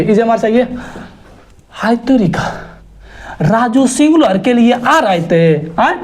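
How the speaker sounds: ordinary talk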